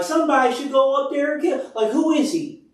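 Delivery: high-pitched, incredulous challenge; indignant disbelief. Retold or imitated. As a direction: retell it as an indignant young challenger. Acting out David's indignant challenge.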